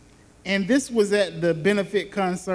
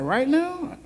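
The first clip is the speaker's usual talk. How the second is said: high disbelieving rise; shocked question; 7 semitones higher than usual. Retold or imitated. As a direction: echo it back in shock. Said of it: "And I'm like".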